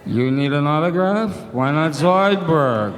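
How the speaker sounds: loud, slow, nasal crab-alien whine; Zoidberg voice. Acted. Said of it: "Who else? Oh, Zoidberg".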